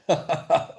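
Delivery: loud, high burst of laughter; real laugh bursting out. Real, not acted. Host cracks up at a chat comment, laughing.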